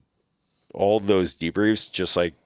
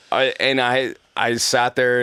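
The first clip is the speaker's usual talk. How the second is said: flustered, stammering start; stumbling, halting restart; stuttering; 5 semitones higher than usual. Real, not acted. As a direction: trip over the first word while retelling. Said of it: "just like I'm stuttering now".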